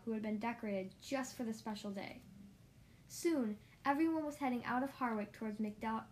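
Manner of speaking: ordinary delivery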